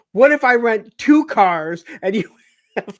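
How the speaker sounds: a hammed-up giggly phone plea; laughing mimicry. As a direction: imitate her laughing as she pleads on the phone. Funny part, she's on the phone.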